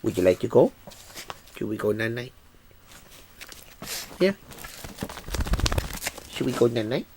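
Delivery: gentle, quiet pet talk; soft bedtime coo. Real, not acted. Asking his dog Nugget to go to bed, soft and coaxing. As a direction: coax the puppy toward bed.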